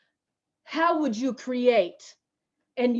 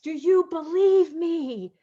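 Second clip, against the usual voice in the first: voice of love; 6 semitones higher than usual. Acted. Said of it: "If love were to ask".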